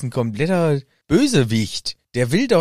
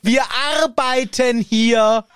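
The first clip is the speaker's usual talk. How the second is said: high, loud, slow, over-simple German; German talking down to foreigners; 12 semitones higher, 3 dB louder, much slower than usual. Retold or imitated. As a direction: speak slowly to a foreigner as if they were dim. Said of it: "So wie Deutsche mit Ausländern".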